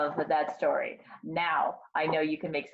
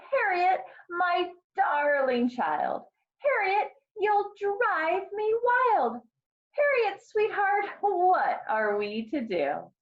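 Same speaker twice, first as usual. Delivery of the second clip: high, drawn-out plea without yelling; exasperated mother; 12 semitones higher, slower than usual. A narrator's reading. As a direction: sing out the exasperation slowly. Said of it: "didn't like to yell".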